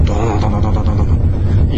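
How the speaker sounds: loud booming drumbeat sounds; drum imitation. A narrator's reading. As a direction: beat out the war drums with your voice. 战鼓响起. (模仿战鼓咚咚声)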